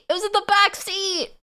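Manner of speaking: squeaky high outrage on back; high-pitched disbelief. Real, not acted. Repeats the same line, higher.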